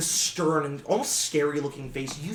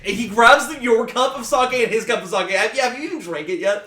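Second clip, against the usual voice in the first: excited; 5 semitones higher, 6 dB louder than usual. A narrator's reading. Narrating a drunken outburst.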